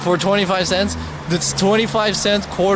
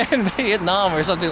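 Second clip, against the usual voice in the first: normal talking voice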